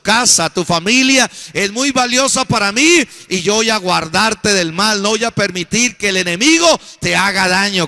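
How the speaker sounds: loud, forceful preaching in God's voice; preacher voicing God. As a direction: proclaim God's promise at full force. El Señor dice. (predicando a toda voz como Dios)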